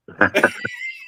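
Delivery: a sudden high shriek of a laugh. Real, not acted. Punchline about burning oil smell.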